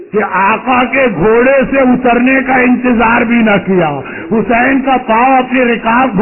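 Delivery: ordinary talk, nothing marked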